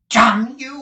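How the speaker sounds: very loud, high angry curse; furious outburst. Acted. Squeers cursing Nicholas in fright and fury, very loud. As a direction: explode at him.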